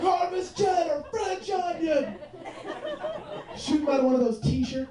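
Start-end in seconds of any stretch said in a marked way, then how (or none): 0.0-2.2 high, slow hype-man calls; slow, high hype-man announcing to a crowd. Retold or imitated. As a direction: call out flavours like a hype man. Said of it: "It's like".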